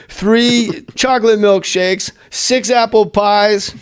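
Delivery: slow, high drawn-out order; lightly voiced big food order; Farley voice.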